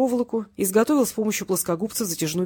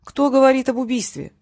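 louder, higher feigned surprise; mock surprise; 5 semitones higher, 5 dB louder than usual. A narrator's reading. Captor to the gang boss, feigning surprise. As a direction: play innocent, wide-eyed. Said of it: "удивился Васильев".

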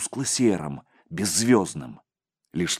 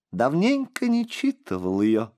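put-on higher, childlike crystal voice; 5 semitones higher than usual. A narrator's reading. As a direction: ring it like a small bell. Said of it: "хрустальным голоском произнёс мальчик". (хрустальный голосок)

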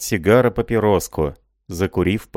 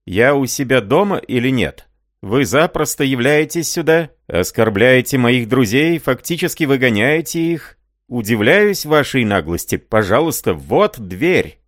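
raised, heated indignation; indignant; 6 semitones higher, 3 dB louder than usual. Acted. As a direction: scold them and point to the door. Рай indignantly throws out guests.

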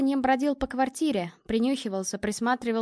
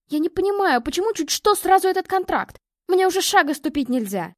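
loud, higher, exasperated outburst; heated protest; 4 semitones higher, 7 dB louder than usual. A narrator's reading. Daughter protesting mother's constant talk of contract, heated. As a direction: argue back with mounting indignation. Daughter argues back in quarrel.

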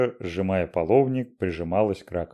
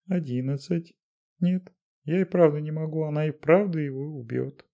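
Dashenka voice; 9 semitones higher than usual. A narrator's reading. Dashenka counting, voiced high.

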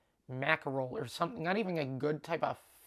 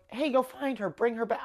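high, falsely helpful urging; mock eager request; 7 semitones higher than usual. Shown as a demonstration. Showing how it was not said to Mike, mock eager. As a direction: send him off as if you mean it. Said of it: "I wasn't going".

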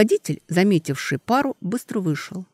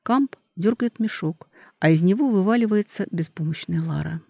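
usual speaking voice

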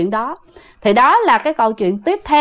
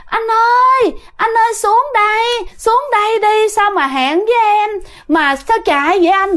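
coaxing, luring ghost woman; 8 semitones higher than usual. Acted. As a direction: lure him down with a wheedling high voice. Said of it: "nói cái giọng như là cái kiểu đẩy đưa".

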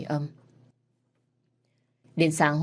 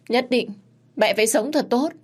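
"Nhất định, mẹ phải sống thật tốt" cried out much higher and louder; anguished cry; 8 semitones higher, 4 dB louder than usual. Acted. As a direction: cry it to his mother as a last plea. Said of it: "Thái Phi lúc này không ngừng gào thét". (gào lên cao và to "mẹ phải sống thật tốt")

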